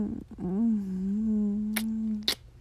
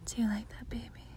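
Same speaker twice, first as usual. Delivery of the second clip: whispering; 8 dB quieter than usual. Acted. Whispers throughout, intimate.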